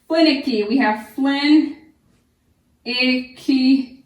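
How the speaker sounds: loud "Finicky", then "flin" and "icky" slow and spaced; syllables split. Shown as a demonstration. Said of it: "Keep your".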